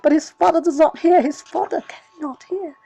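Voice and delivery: loud, rising with grief; loud anguished narration. A narrator's reading. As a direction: build the narration into a cry. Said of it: "He screams".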